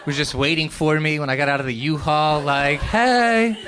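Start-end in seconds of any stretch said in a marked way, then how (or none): 2.8-3.6 high, loud, held hey; flirty greeting. Retold or imitated. Best friend waiting at the U-Haul, flirty hello. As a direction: sing out a welcoming hey.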